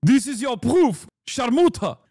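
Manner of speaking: put-on high Israeli-accent sneer ending in a curse; mock Israeli accent, spitting. Israel defender cursing at whoever wants proof, in a spitting mock Israeli accent. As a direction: spit out the last word in a thick accent. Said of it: "have to spit at the end".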